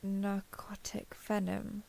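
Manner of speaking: slow, hushed, near-whispered echo; stunned disbelief. Acted. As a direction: repeat it under your breath, taking it in. Stunned repeat of a revelation.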